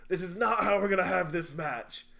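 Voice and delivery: imitated yell. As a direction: imitate an authority halting a brawl. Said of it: "and he yells".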